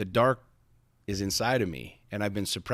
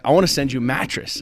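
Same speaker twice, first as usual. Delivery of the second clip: quoting the mattress guy, higher and louder; upbeat quote; 5 semitones higher, 7 dB louder than usual. Retold or imitated. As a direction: pitch it like a friendly offer. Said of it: "He's like".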